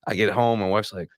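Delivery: usual speaking voice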